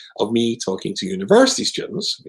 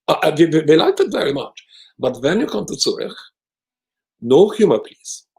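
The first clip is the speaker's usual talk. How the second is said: higher, louder stern imitation; mock Swiss organizer; 6 semitones higher, 5 dB louder than usual. Organizer forbidding humor to the rabbi, stern mock voice. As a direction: lay down the rules like a stiff Swiss official. Mark laying down rules.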